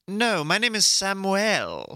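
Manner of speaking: high, drawn-out character voice; put-on character. Student answering roll call as a new character, put-on high voice. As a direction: correct them in a slow, hammed-up high voice. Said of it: "this character's not working".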